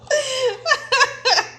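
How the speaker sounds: high burst of laughter. Real, not acted. Laughs at [name] joke.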